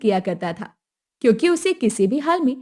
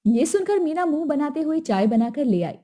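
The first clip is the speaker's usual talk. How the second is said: ordinary delivery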